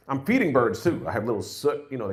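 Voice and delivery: ordinary delivery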